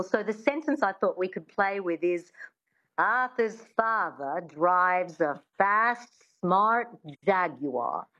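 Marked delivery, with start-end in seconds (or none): 3.0-8.1 slow transatlantic accent with Rs dropped. Shown as a demonstration. Coach giving a practice sentence, slow transatlantic accent. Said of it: "use a sentence to get into accents".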